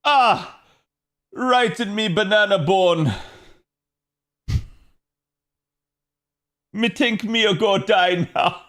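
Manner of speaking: mock wail of banana-bone agony, played broad; imitating a pained outcry. Ash retelling a near-scream of agony, hammed-up pained wail. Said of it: "Ash was like".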